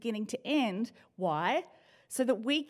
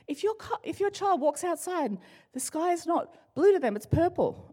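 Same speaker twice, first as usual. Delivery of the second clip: higher, lightly put-on principal voice; mocking the principal; 7 semitones higher than usual. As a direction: parody the earnest principal, pitched up. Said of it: "the guy was literally saying".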